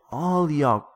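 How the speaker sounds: dramatic yell. Acted. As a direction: yell it like an exasperated storyteller. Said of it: "I yell".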